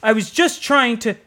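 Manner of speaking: mock-scared driver cut off; frightened protest. A narrator's reading. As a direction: blurt it out scared.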